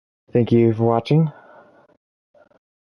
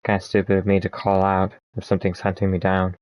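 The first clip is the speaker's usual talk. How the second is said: normal talking voice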